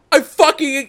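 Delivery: sudden loud high outburst; outraged shout. Retold or imitated. Retelling his reply to a lazy-accusing commenter, outraged shout. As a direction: yell it out in disbelief. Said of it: "was like".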